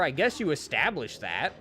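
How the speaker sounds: usual speaking voice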